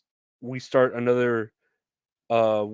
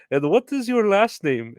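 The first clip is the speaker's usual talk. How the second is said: higher, pointed question from the caller; customer on the phone; 9 semitones higher, 4 dB louder than usual. Retold or imitated. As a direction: ask it as a prim, higher-pitched caller. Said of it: "she just goes".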